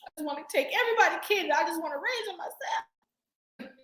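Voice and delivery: hammed-up heartstrings-tugged wail; tearful mock gushing. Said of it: "you be like".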